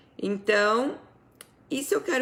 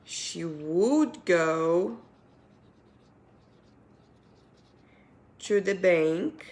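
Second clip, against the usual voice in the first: slow dictation; much slower than usual. Shown as a demonstration. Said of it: "vou colocar aqui".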